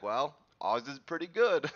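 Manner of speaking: put-on amateur New York accent, emphatic; attempted New York accent. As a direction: imitate a New York cop defending his local spot. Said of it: "I'm doing some sort of accent".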